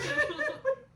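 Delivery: high, loud burst of laughter. Real, not acted. Finishing the scary story for friends, then laughing loudly. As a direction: break into laughter after the scare.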